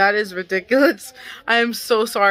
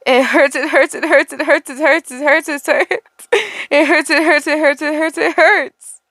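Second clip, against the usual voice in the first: rising, louder "it hurts" over and over; pained repeated cry; 5 semitones higher, 3 dB louder than usual. Acted. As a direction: cry out in pain, faster and faster.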